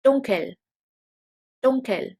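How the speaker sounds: dunkel, dunkel, falling; German pronunciation. Shown as a demonstration. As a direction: teach dunkel slowly and clearly. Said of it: "In German we say".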